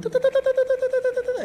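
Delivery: rapid da-da noise on one high note; high-pitched muted babble. Retold or imitated. Child mutes angry mom, hearing only high droning babble. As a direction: imitate tuned-out scolding as monotone chatter. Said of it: "all I could hear was".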